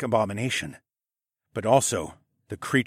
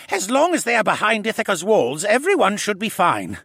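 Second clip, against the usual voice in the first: put-on raised, gentle voice of the old koala scholar; Jenner's voice; 11 semitones higher, 6 dB louder than usual. A narrator's reading. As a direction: speak up as the fussy, kindly koala gentleman. Jenner speaks, narrated.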